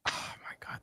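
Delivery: breathless whisper of delight; whispered oh my God. Real, not acted. Fan gushing to friend about the show, whispered. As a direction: hush it out in awe.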